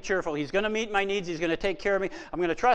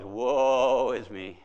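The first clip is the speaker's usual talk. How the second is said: very slow, lower, hammed-up moaning; mock gloomy lament; 7 semitones lower, much slower than usual. Cartoon character always complaining, mock doleful drawl. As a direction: drag it out like a sad sack under a cloud. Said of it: "it was always".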